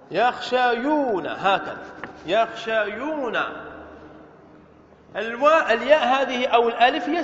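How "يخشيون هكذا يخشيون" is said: يخشيون slowly, each syllable clear; slow demo. Shown as a demonstration. Teacher to students, slow careful يخشيون. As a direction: lay out يخشيون slowly for the class. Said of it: "يخشى".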